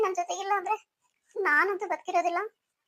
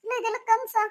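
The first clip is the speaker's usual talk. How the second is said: high, tearful calling out; wife sobbing his name; crying; 4 semitones higher than usual. Acted. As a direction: call for him through tears. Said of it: "ಯಾಕೆ ಅಳ್ತಿದ್ಯಾ".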